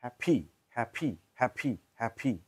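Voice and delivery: হ্যাপি with a breathy aspirated p, British style; হ্যাপি said hap-hi, British way; British happy. Shown as a demonstration. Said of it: "ব্রিটিশরা বলে". (British উচ্চারণে হ্যাপি)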